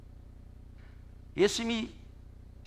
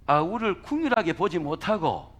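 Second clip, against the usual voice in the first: ordinary talk, nothing marked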